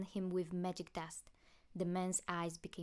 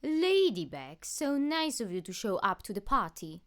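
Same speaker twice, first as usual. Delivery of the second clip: villain taunt; 3 semitones higher, 11 dB louder than usual. Acted. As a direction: sneer the greeting like a gloating villain.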